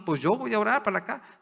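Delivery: a hint of a know-it-all voice; mocking quote. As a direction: toss it off like a smug skeptic. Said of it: "tú mismo así".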